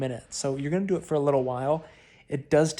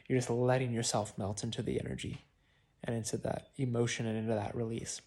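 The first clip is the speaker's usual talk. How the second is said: guided meditation; 3 semitones lower, 7 dB quieter than usual. Acted. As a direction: ease them into melting, softly.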